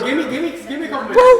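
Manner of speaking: fast laughing plea. Real, not acted. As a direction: rush it while laughing. Laughing, rushing.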